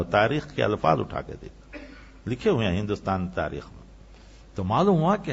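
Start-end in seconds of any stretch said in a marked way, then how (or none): none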